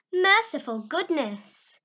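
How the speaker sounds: put-on an old lady jolted awake crying out; startled cry. A narrator's reading. Startled aunt woken in bed, crying out loud. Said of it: "somebody muffled said in muffled accents".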